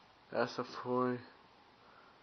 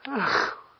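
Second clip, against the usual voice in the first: loud laugh; 11 semitones higher, 10 dB louder than usual. Real, not acted. Laughing at own blunder. (gargalhada alta)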